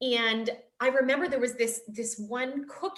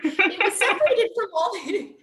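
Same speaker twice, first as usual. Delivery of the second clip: loud, high, helpless laughter through the words; real laughing while talking; 9 semitones higher, 5 dB louder than usual. Real, not acted. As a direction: try to speak through a laughing fit. Laughing as she starts the story.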